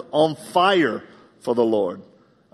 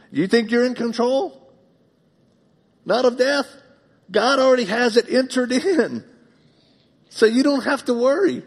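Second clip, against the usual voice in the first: high, loud preacher's challenge to the congregation; preaching at full force; 13 semitones higher, 5 dB louder than usual. Acted. Pastor challenging the congregation about control over death, full-force preaching. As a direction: drive it home like a pulpit climax.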